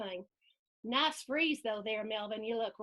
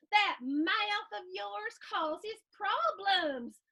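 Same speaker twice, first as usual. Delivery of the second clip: higher, slow mother's voice; scolding mom; 6 semitones higher, slower than usual. Acted. Mom reacting to Melvin's speeding ticket, slow scolding. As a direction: draw out the reproach at a raised pitch.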